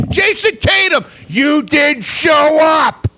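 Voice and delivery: shouting, key words dragged out; angry rant. Real, not acted. Fan tells the star he didn't show up, angry rant. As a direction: hammer the accusation home at full steam. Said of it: "I'm going on a fucking rant".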